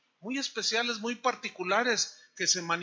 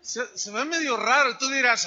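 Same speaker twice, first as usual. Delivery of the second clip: ordinary talk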